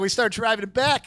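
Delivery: ordinary delivery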